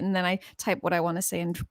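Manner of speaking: ordinary talk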